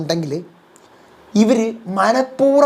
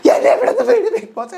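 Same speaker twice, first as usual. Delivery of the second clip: much higher wail with a laugh; repeated plea now pitched up to a squeal; hysterical Jasmine; 13 semitones higher than usual. Acted. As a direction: let the plea crack into a shrieking wail. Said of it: "അപ്പൊ Jasmine വീണ്ടും".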